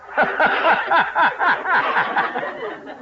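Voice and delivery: a high rising snicker; mocking laugh. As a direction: laugh at the amateur builder. Contractor laughs at Blandings.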